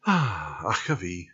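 Welsh exclamation of disgust; South Welsh accent. Shown as a demonstration. Capping the Welsh word list, South Welsh accent. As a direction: utter it like a South Walian. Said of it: "That's Welsh".

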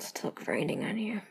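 ordinary talk

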